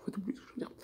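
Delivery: muttered whispered aside; whispering. Real, not acted. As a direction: whisper it to yourself.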